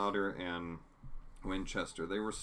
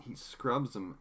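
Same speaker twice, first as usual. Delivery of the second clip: ordinary delivery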